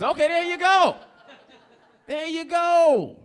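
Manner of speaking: high, loud, drawn-out exclamation; loud approving cheer. Real, not acted. Comic hails an audience answer, loud delighted cheer.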